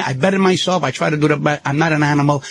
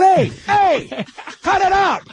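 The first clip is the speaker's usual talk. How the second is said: retold yell; 11 semitones higher, much slower than usual. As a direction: yell up high to make him stop. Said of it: "I'm going".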